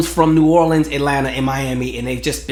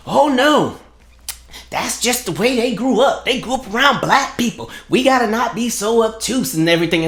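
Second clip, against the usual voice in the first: high, singsong mocking voice; mock defender; 7 semitones higher than usual. Mimicking people who make excuses for others, high mocking voice. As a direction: put on a higher voice to parody a naive apologist. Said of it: "One argument is".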